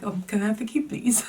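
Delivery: high, hushed comic pleading; sheepish request. Retold or imitated. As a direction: ask small and sheepishly, retelling it. Sheepish request after storming out.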